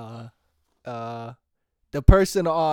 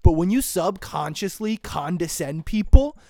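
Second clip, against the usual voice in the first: ordinary delivery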